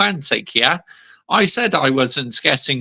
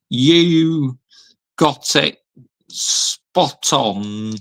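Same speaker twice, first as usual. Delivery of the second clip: slow, broken into chunks as he types; typing aloud; much slower than usual. Real, not acted. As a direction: drag each word out while typing. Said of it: "I'm gonna actually post a comment".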